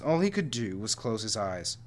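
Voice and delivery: ordinary delivery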